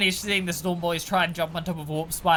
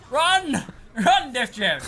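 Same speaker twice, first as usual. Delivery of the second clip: high, loud laughing shout of alarm; excited yell; 8 semitones higher, 6 dB louder, much slower than usual. Real, not acted. Caster yelling at the fleeing Death Dread, excited shout. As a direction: yell at the unit to run, laughing.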